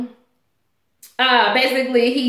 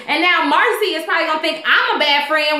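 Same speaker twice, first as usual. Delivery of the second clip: ordinary talk, nothing marked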